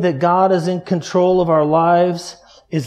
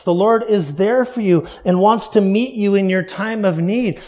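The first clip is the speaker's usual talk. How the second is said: normal talking voice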